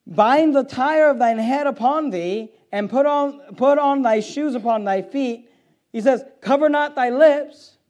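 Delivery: loud, high, slow scripture proclamation; forceful preaching. Acted. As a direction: proclaim each command to the congregation.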